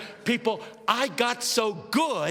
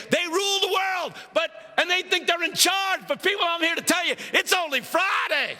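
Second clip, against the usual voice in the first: impassioned preaching; 6 semitones higher than usual. Acted. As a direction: build to a triumphant pulpit cry. Preaches the pastor's sermon at full force.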